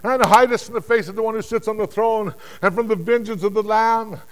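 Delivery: high, sustained shouting; loud, pleading wail of words; desperate crying out. Acted. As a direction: cry out to the mountains in dread. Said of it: "they cried out to the mountains".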